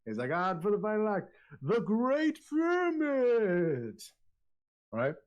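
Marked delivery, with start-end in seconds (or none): 0.3-4.0 high-pitched showman's proclamation, stretched at the end; loud announcer voice. Acted. Jester introducing the final act, high booming announcer. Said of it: "really loud".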